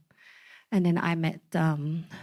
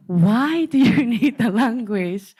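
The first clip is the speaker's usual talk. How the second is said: high, loud, slowed, laughing through the words; mock grandma's baffled plea, half laughing; grandma's stunned laughing question; laughing disbelief; 6 semitones higher, 8 dB louder, slower than usual. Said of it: "my grandma cried so hard".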